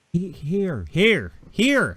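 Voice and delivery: high, loud imitated calls; calling out. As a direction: call out like a soldier in a film. Muffled calls imitated from war film.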